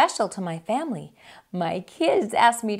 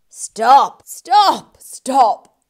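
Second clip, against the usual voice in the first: loud, rising repeated stops; interrupting shout; 11 dB louder, slower than usual. Acted. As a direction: cut her off hard. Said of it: "The rat interrupts".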